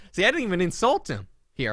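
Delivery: usual speaking voice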